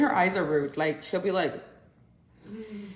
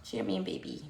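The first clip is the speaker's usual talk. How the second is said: ordinary talk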